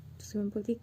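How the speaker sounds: usual speaking voice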